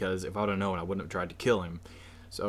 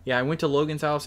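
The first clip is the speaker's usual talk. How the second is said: loud; 5 semitones higher, 5 dB louder than usual. Real, not acted. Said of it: "I'm sorry for that loudness".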